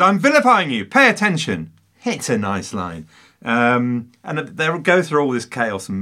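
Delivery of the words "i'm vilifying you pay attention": higher, louder mock yell. Retelling a film scene, a king yelling at his wife, hammed-up. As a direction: bark it out like a king scolding. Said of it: "Peter O'Toole is yelling at Catherine".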